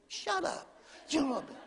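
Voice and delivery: sputtering mock annoyance; mock ticked-off husband. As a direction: play at indignant protest. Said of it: "I'm ticked off".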